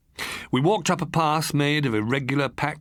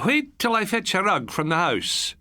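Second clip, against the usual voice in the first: put-on light Highland accent; 9 semitones higher than usual. A narrator's reading. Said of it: "traces of the pure Highland accent".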